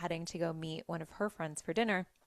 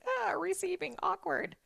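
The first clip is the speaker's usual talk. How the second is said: high, squeamish little voice; mock squirming; 12 semitones higher than usual. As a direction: act out awkward discomfort, pitched way up. Voices own discomfort at receiving help.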